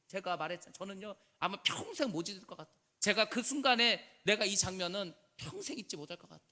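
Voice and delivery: ordinary talk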